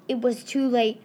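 ordinary delivery

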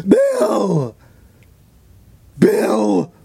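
high, wailing mock call; calling the dog. Crickets calling a dog that is not hers, high imitated wail. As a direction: keep calling hopelessly, hammed-up. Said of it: "she keeps calling the dog".

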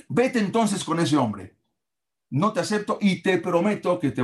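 angry father. Acted. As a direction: spit out each word of rejection. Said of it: "el papá ahí se enojó mucho".

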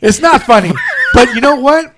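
loud protest breaking into laughter; anger turning to laughter. Real, not acted. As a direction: shout it, then crack up laughing. Insists while laughing.

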